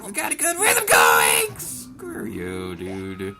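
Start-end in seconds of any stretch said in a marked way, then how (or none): 0.1-1.6 high, loud squeal; excited shout. Real, not acted. Excited during attack rhythm.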